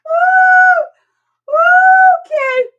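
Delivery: high, loud whooping cry; whooping shout. Real, not acted. Whooping to chat after laughing fit. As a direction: let out a huge woo.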